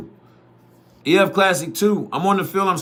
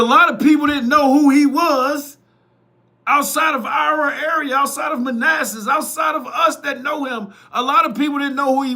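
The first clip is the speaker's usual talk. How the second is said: emphatic shouting; 7 semitones higher than usual. Real, not acted. Heated defence of coach TJ.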